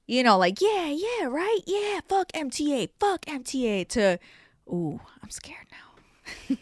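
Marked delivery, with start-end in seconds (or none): 0.6-3.8 high, keen cheering along; hammed-up enthusiastic agreeing; mock eager agreement. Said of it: "she went from". 4.7-6.6 slow whispered fright; imitating a frightened whisper; mock scared whisper. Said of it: "she went from ... to".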